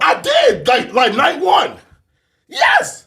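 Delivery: high, loud shout of protest; defensive shout. Real, not acted. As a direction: yell it back, cut to the quick. Defending himself, shouting back.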